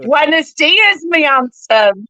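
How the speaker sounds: Spanish greeting in a thick West Country burr; West Country accent. Retold or imitated. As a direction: Cornish miner speaking Spanish. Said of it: "but in a really strong West Country British accent".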